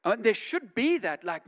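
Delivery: ordinary talk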